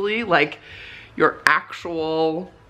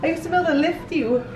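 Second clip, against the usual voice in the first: fast, high pet talk; 13 semitones higher, much faster than usual. Real, not acted. Laughing at dog.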